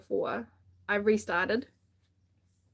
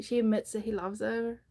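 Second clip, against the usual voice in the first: ordinary talk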